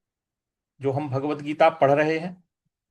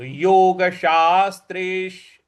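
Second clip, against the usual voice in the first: योगशास्त्रेश slow and louder, weight on श्र; stress on श्र; 4 dB louder, slower than usual. Shown as a demonstration. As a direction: hit the श्र in योगशास्त्रेश firmly. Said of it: "इस प्रकार से बोलेंगे". (योगशास्त्रेश में श्र पर ज़ोर)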